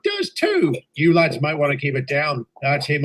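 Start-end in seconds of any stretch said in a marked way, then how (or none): 0.0-0.7 high squeal of insistence; childish retort. Acted. Bickering engines, final high childish insistence. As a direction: top the argument, pitch shooting up.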